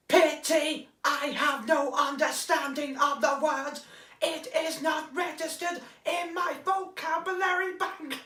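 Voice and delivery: high, slow shouted Dalek rasp; tense the jaw and bark like a Dalek. Shown as a demonstration. Demoing a Dalek line for the viewers, slow high Dalek shout. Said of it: "So, I will think of a line".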